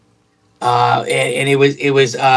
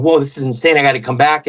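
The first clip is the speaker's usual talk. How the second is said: ordinary delivery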